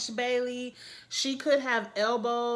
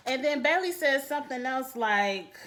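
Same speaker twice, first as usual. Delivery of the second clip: usual speaking voice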